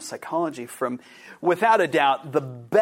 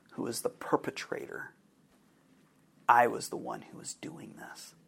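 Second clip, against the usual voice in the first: soft unvoiced whisper; hushed whisper; 4 semitones lower, 8 dB quieter than usual. Real, not acted. Telling how she saw her own face, in a hushed whisper. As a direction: breathe the dark revelation. Confessing the dream's horrible twist.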